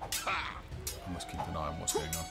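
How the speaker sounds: ordinary delivery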